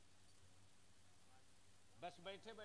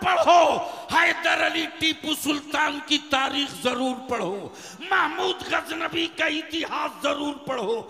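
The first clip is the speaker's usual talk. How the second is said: loud, ringing sermon oratory; full-force preaching; 10 semitones higher, 23 dB louder than usual. Real, not acted. Preacher telling the crowd who never earns sainthood, full-force preaching. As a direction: hurl each line at the congregation.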